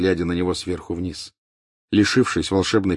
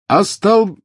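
high, loud cut-off spell; shouted spell; 13 semitones higher, 8 dB louder than usual. A narrator's reading. Harry casting spell, cut off.

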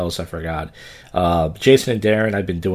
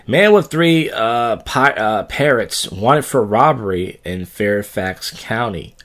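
odd news read; 3 semitones higher, much slower than usual. Acted.

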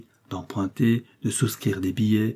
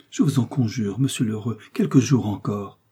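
usual speaking voice

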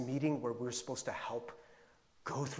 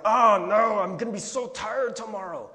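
loud, higher exasperated groan of complaint; pissed off; 5 semitones higher, 10 dB louder than usual. Retold or imitated. Preacher imitates himself dreading tomorrow's tiredness, pissed-off outburst. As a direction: burst out in acted frustration. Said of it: "really just kinda pissed off".

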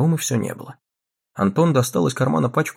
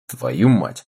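lightly voiced cursing boy; 7 semitones lower than usual. A narrator's reading. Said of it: "сказал Рома".

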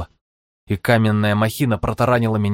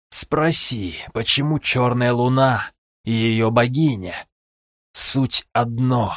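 slow, cold gloating villain; 2 semitones higher, slower than usual. Acted. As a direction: lay it out slowly, menacing. Villain voice in his head.

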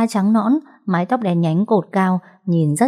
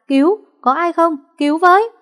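high, loud desperate yell; crying for help; 10 semitones higher, 3 dB louder than usual. A narrator's reading. Boy calls out high and loud for help. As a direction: shout for anyone to come. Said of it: "la hét".

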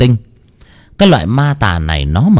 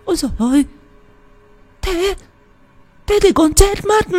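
frightened stammering. A narrator's reading. Wife hears the shaman's grim news, frightened stammering. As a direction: stammer in a tiny, panicked high voice. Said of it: "lắp ba lắp bắp".

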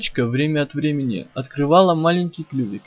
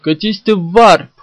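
loud, higher defiant yell; 5 semitones higher, 10 dB louder than usual. Acted. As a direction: scream it at the monster. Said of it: "завопил я".

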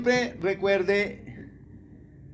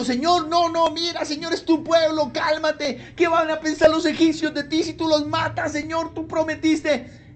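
higher, urgent begging; pleading Moses; 6 semitones higher than usual. Acted. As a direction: plead with an angry God to calm down. Moses begging God to calm. (Moisés suplicando, voz más aguda)

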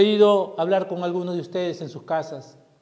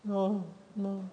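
put-on meek child; 10 dB quieter than usual. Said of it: "¿O estás arrepentido de tu pecado?".

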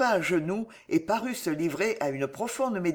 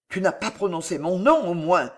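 usual speaking voice

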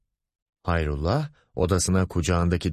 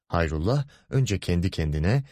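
ordinary delivery

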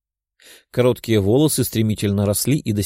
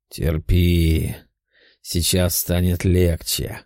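reassuring; 5 semitones lower, much slower than usual. A narrator's reading. Demon calms girl in pain.

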